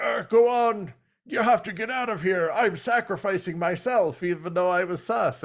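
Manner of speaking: high, loud mock noble sacrifice speech; the dying hero urging his friend away; hammed-up heroic farewell. High loud mock farewell. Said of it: "And he's like".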